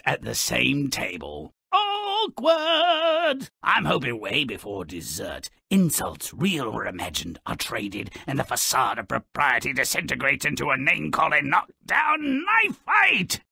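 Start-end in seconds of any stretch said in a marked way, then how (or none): 1.7-3.5 mock sing-song. Real, not acted. Mocking the royal seating plan. 11.5-13.4 gleeful. Real, not acted. Relishing imagined brawl.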